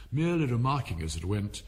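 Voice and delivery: plain reading voice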